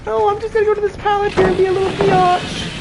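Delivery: high, mincing mock voice of a cocky player; mocking survivor. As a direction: mimic a smug player in a squeaky falsetto. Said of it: "She's like".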